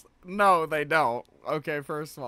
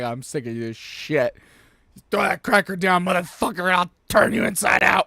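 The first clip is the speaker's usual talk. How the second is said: fed-up mom; 9 dB louder than usual. Retold or imitated. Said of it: "She's like".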